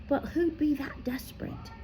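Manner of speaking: hushed, breathless whisper; whispered question. A narrator's reading. Harry wondering to himself, a soft whisper. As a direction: breathe it out in dread.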